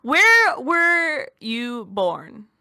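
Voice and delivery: loud, drawn-out demand; a slow, shouted challenge to a friend; slow shouted question. Real, not acted. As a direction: call him out, slow and loud. Accusing friend of not Minnesota.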